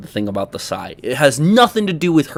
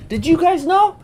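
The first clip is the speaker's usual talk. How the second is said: mock astonishment; 14 semitones higher than usual. Sarcastic question to chat.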